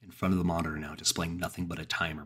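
usual speaking voice

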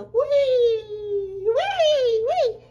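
long high squeal of "wee"; gleeful "wee". Acted. Storyteller acts the jump from the tree, squealing. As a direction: squeal loudly all the way down.

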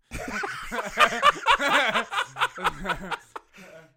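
long, loud high-pitched laugh; real burst of sustained cackling; hearty laugh. Real, not acted. Listener cracks up at own story.

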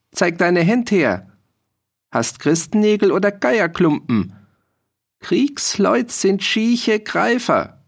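put-on high, slow, jeering old peasant voice; mocking old farmer. A narrator's reading. Old farmer taunts the soldier about his hands.

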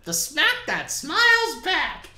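high, slow cartoon jingle voice; goldfish cracker jingle. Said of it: "Okay, ready?".

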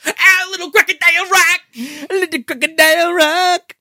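high, loud sung-out Elton voice; [name] impression. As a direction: belt it like a hammy [name]. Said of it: "my Simon Basel impression".

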